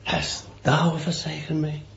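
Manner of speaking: hushed, breaking cry of despair; a dying man's anguished whisper. Retold or imitated. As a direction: whisper it out as if forsaken. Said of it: "in the darkness he cried out".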